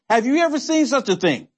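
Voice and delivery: normal talking voice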